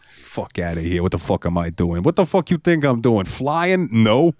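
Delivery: hammed-up indignant New York penguin; thick New York accent. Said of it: "a thick New York accent".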